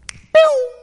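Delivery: a sharp, high "pew" for the dog shooting off; mock whoosh sound. Pastor telling how the dog escaped, a mock whoosh. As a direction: fling out a quick zip noise like she vanished. Dog bursting through the fence.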